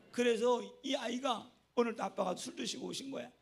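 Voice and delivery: ordinary talk, nothing marked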